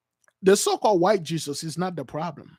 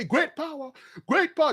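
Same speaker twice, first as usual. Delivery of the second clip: high-pitched imitation of pleading worshippers; mock invocation; 9 semitones higher than usual. The other side calling their power, high mock plea. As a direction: call on a god in a high mocking voice. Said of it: "the dudes, they were calling".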